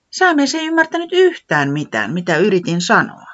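high little voice, Säämies lifted high; Säämies said high and frustrated; Tiputi complaining. Acted. Tiputi complaining to Pöllis, high and frustrated.

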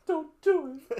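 high, loud mock-whiny echo. Teasing co-host parrots his friend's plea, mockingly high. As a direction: throw it back in a squeaky voice.

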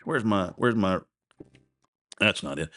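ordinary talk, nothing marked

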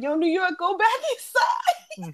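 high, slow hyped-up [name] voice; [name] impression. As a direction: holler at her like [name] from the car. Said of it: "and he's like".

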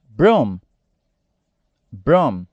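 "Broom" with long oo and closed m; model English word. Shown as a demonstration. Teacher modeling vocabulary for students, clear English. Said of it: "que é bota".

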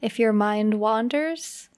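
unhurried meditation guide with long pauses; measured, spacious delivery for relaxation; guided meditation. Acted. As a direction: ease the listener slowly into relaxing.

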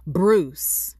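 loud retold call to a neighbor. Calling out the neighbor at morning.

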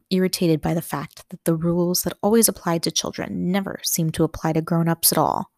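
usual speaking voice

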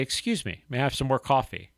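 soft, polite imitated customer; polite request. Nice customer asking the waitress for coffee, soft polite request. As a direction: ask quietly and courteously. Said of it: "But they say".

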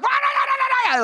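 high shouted angry gibberish; mock an accent on steroids, shrieked nonsense; angry, frustrated. Peter losing it in Galilean accent, angry frustrated babble. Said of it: "getting so angry and frustrated".

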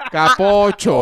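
loud mock insult; scolding character. Host capping his imitation of the character, mock scolding. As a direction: throw it out as a joking reproach.

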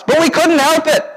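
high, mock whining plea; hammed-up helpless excuse; whiny excuse. Preacher mimicking Israel pleading helplessness, high mock whine.

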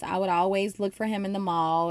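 normal talking voice

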